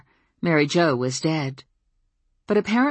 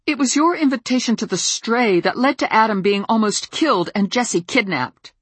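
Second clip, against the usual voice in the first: gritted teeth; 7 semitones higher, 3 dB louder than usual. Acted. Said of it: "between gritted teeth".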